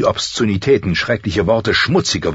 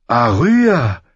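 loud, higher call of disbelief; incredulous cry; 5 semitones higher, 4 dB louder than usual. A narrator's reading. Sansa discovering her sister fighting, incredulous cry. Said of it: "rief sie ungläubig aus".

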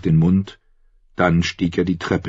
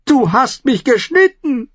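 shrill pained cry, much higher and louder; screaming; 5 dB louder than usual. Acted. The cut singer screams at the stranger, high and loud. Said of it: "der Sänger schrie".

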